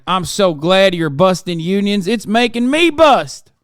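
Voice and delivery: a gushing, hammed-up union buster; mocking Mark Wayne Mullin. Streamer mocking senator's love of union busting, hammed-up. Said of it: "Mark Wayne Mullen".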